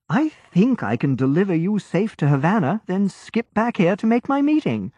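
put-on an obliging young sailor, easy and sure; cheerful young lieutenant. A narrator's reading. Lieutenant answers Grey.

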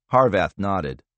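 plain reading voice

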